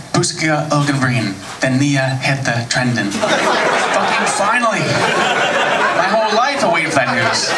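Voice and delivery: ordinary talk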